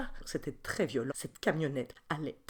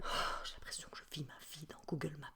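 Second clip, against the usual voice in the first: whispered aside; 2 semitones lower, 12 dB quieter, faster than usual. Acted. Stressed mother's aside.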